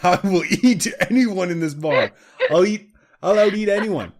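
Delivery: loud, high boastful monkey bragging. Retold or imitated. Retelling a joke, the monkey brags loudly to the bar. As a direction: crow it like a cocky monkey.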